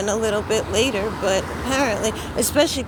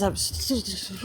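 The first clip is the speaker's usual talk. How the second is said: shivering, teeth chattering from cold; 6 dB quieter than usual. Real, not acted. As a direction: stammer through chattering teeth. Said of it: "I don't know why my teeth are chattering".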